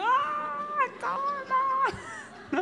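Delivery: respuesta sarcástica, mucho más aguda; un "¿cómo no?" burlón y agudo, de reproche; sarcasmo llorón. Retold or imitated. Ella responde al reclamo. (tono sarcástico muy agudo)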